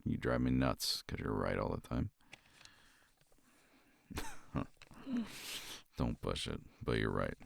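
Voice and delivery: ordinary delivery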